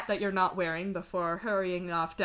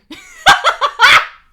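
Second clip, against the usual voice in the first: high, very loud burst of laughter; real laugh; 21 dB louder than usual. Real, not acted. Narrator laughing at their own accent plan, loud.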